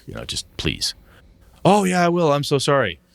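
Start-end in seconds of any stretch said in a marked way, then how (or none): 1.6-3.0 high, louder mock apology; hammed-up empty apology from the late author. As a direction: parrot the author's breezy promise. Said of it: "hounding the dude".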